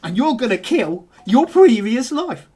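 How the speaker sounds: fast, high rallying speech building to shouts; fired-up dock worker. Acted. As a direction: whip him up like a motivational preacher. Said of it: "He goes".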